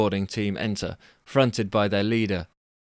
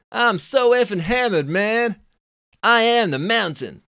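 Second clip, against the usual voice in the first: high, loud Californian dude scoff; 12 semitones higher, 5 dB louder than usual. Acted. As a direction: squeak up the pitch like a cocky Californian. Drunk Billy boasting.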